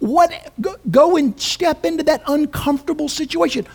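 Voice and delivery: normal talking voice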